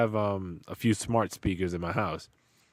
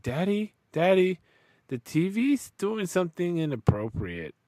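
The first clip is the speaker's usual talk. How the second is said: put-on much higher little kid calling Daddy; toddler voice; 9 semitones higher than usual. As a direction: call out like a worried two-year-old. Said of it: "She's like".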